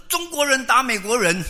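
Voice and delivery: put-on high, loud shout of a child; child's yell. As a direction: yell it out to the neighbours. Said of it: "就大喊". (小孩尖声大喊)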